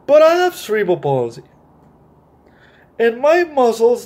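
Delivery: bright, lively storybook read-aloud; animated picture-book narration; reading to children. A narrator's reading. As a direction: read it like story time for kids. Reads Nathan's first-person lines.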